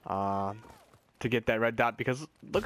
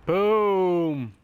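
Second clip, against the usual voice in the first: triumphant boom; 5 semitones higher, 7 dB louder than usual. Real, not acted. Scoring a kill.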